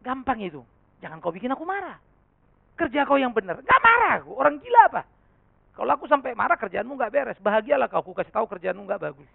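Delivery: loud, high, angry telling-off; a pastor shouting at office staff; angry boss. Retold or imitated. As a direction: scold the lazy worker at full volume. Retelling his angry replies to staff.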